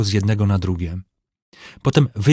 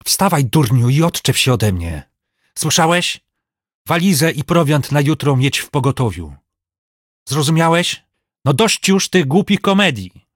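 high, furious scolding; sudden fury; 5 semitones higher, 3 dB louder than usual. A narrator's reading. Master ordering his servant to get up, furious. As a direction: order him off in exasperated rage. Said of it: "Pomianem miotnęła nagła wściekłość".

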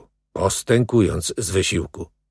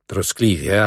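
ordinary delivery

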